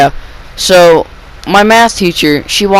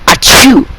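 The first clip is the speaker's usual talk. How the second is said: loud mock sneeze, higher; imitated sneeze; 6 semitones higher, 4 dB louder than usual. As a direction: fake a big sneeze. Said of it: "I don't know what it sounds like".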